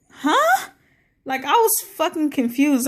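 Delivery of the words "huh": squeaky high huh, way up in pitch; a sudden high yelp of disbelief; shocked huh. Retold or imitated. Telling the show's story, she recalls her shock: high surprised huh. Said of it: "I'm like".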